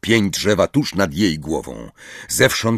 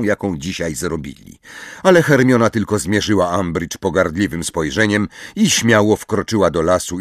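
usual speaking voice

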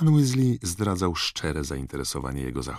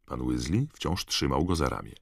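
usual speaking voice

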